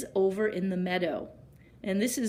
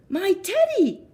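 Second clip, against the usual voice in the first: high, louder glad cry; cried out; 12 semitones higher, 6 dB louder than usual. A narrator's reading. Eddie grabbing his own teddy, a high glad cry. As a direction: cry out with relief at finding him. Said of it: "cried Eddie".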